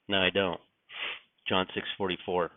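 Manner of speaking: ordinary talk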